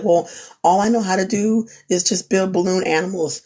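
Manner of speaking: ordinary talk, nothing marked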